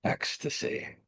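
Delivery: soft, near-whispered echo of the word; softly breathed, almost voiceless; hushed repeat. Real, not acted. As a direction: let the word fall away into breath. Theme word repeated in meditation.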